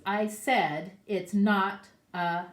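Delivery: slow, loud, drawn-out insistence; insistent rabbit. Acted. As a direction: rabbit losing patience, slowly. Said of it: "And he says".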